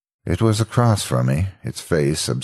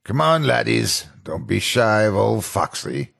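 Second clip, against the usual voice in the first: lightly voiced pirate skit; 6 semitones higher than usual. Animatronic repeating his kids' show skit, lightly voiced pirate. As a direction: recite the old stage skit. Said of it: "He only said that".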